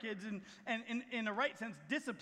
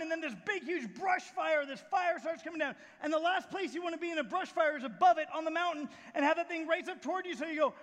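high, raised storytelling voice; preaching at full force; 5 semitones higher, 6 dB louder than usual. Acted. Preacher retelling the tornado on the mountain, full-force preaching. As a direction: build the storm in a raised, urgent voice.

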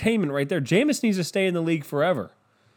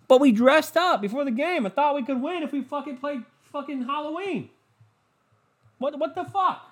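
mock dim player; 11 semitones higher than usual. Mocking Texans players' logic.

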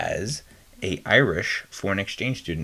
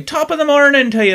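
high, loud stage-Irish greeting; Irish accent; 9 dB louder than usual. Shown as a demonstration. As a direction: belt it out in a hammy Irish accent. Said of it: "but in an Irish accent".

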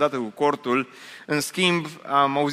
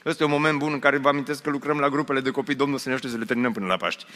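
quick, quieter announcement slipped in; rushed aside; 4 dB quieter, much faster than usual. Real, not acted. Quick announcement inside sermon.

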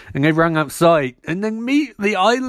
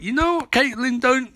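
high warning; 9 semitones higher than usual. Retold or imitated. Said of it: "Ronnie's like".

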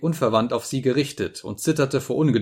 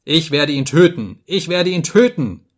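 loud, high cry climbing on the repeat; acted screech; 5 semitones higher, 6 dB louder than usual. A narrator's reading. Narrator voices raving man pounding the table, acted screeching. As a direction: screech the threat, rising in pitch. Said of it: "kreischte ich".

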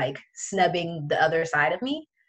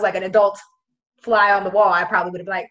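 normal talking voice

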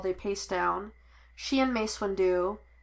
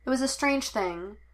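ordinary delivery, nothing marked

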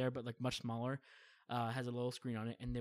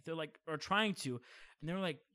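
normal talking voice

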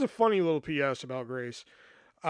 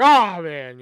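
a much louder mock-surprised oh man; fake surprise; 13 dB louder than usual. Acting surprised for the manager, loud fake dismay. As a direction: put on a show of surprise for the manager. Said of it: "I had to act surprised".

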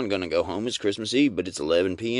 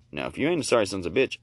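ordinary talk, nothing marked

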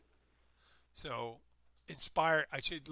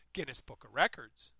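usual speaking voice

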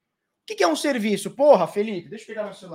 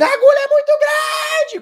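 put-on much higher, loud, panicked child's shriek; daughter screaming; 6 dB louder than usual. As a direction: shriek like a little girl scared of the needle. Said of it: "Começou a gritar". (filha gritando apavorada com a agulha, voz aguda de criança)

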